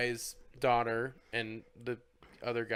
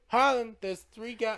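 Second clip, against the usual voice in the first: calling out; 9 semitones higher, 3 dB louder than usual. Retold or imitated. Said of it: "she calls out".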